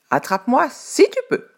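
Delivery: very high, loud teasing taunt; cheeky dare. A narrator's reading. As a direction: taunt like a tiny runaway. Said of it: "pancake teasing and fleeing".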